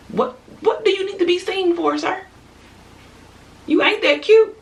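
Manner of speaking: high-pitched, incredulous put-down; mock scolding. Woman to men needing to be seen, mock scolding. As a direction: squeal it at him in mock disbelief. Mocking the attention-seeking man.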